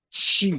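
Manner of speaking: chi said with a sh-like ch and a high tone; chi in Mandarin, not ki; Chinese chi. Shown as a demonstration. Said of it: "Q I ini disebutnya chi".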